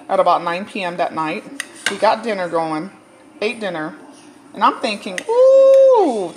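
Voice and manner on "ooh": high squealed ooh of relief; a sudden high sigh of relief; relieved ooh. Retold or imitated. Relieved exclamation in retold thought.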